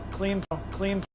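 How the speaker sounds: ordinary delivery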